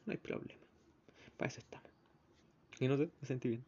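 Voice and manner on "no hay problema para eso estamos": unvoiced whispered reassurance. Reassuring the old lady in a whisper. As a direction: breathe the words softly. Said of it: "whispered reply".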